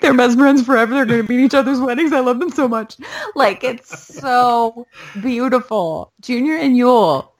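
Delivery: high, fast, gushing with joy. Real, not acted. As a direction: rave about them like a fan. Gushing about the characters.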